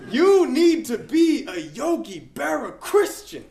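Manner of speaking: loud, high, hammed-up New Yorker; comic high-pitched New York impression; Billy, high-pitched New York accent. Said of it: "in his high-pitched New York accent".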